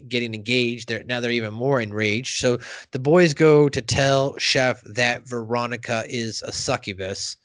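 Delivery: usual speaking voice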